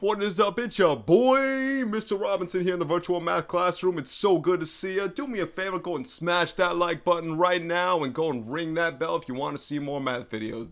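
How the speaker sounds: high, loud, over-the-top YouTuber intro; put-on hype YouTuber voice. Math teacher greeting YouTube viewers, hammed-up high hype voice. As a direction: shout it like a hammed-up hype man. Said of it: "I don't know why I'm doing this voice".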